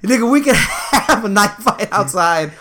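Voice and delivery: laughing through the words; cracking up while quoting. Retold or imitated. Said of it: "Where I was like".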